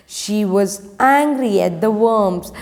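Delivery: usual speaking voice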